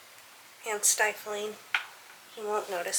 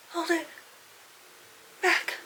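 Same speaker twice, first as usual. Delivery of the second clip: about to sneeze; 8 semitones higher than usual. Acted. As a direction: voice rising before a sneeze. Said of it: "I'm gonna sneeze again".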